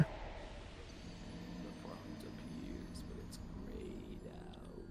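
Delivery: slow, low rasping growl; low gravelly voice. Acted. Continues in same gravel voice.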